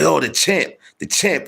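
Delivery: high teasing sing-song praise; high mocking "the champ" call; mock coworker praise. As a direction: tease him like a fawning coworker. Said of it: "He's like".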